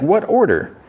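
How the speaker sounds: very high, indignant question; incredulous challenge. Retold or imitated. Woman answers accusation of disobeying an order, incredulous.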